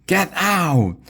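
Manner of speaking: high-pitched angry yell; dad's scream. A narrator's reading. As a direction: scream it at an unwelcome visitor. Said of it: "my dad screamed at her".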